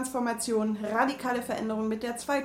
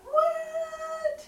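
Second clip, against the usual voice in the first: high squeaky What; shocked, pitched way up; surprised; 3 dB louder than usual. Real, not acted.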